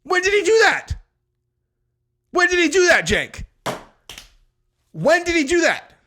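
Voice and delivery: high, loud question fired again and again; demanding shout. Real, not acted. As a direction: yell it at the screen, insisting on an answer. Yelling questions at the clip.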